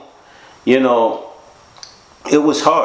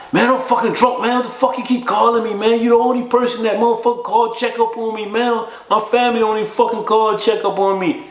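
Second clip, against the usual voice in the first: high, rapid agitated complaint; retold agitated rant; 11 semitones higher than usual. Friend telling caller to stop checking on him, retold agitated. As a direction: rant fast in a raised pitch, imitating him. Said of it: "He sound like that".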